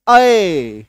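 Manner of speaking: अय loud, drawn out from a towards y; double-matra vowel. Shown as a demonstration. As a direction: stretch अय into a strong open glide. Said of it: "double एकार की मात्रा को जन्म देते हैं".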